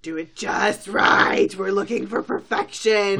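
loud, emphatic perfectionist order; demanding. Acted. Rarity telling her sister to garnish the plate, loud demanding voice. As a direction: belt out the exacting instructions.

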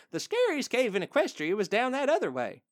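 high, lilting granny voice; reassuring granny. Retold or imitated. Granny pony reassuring a child, high and breezy.

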